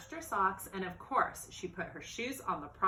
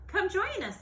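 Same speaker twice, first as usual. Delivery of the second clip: friendly call; 11 semitones higher, 4 dB louder than usual. Acted. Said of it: "said Millie".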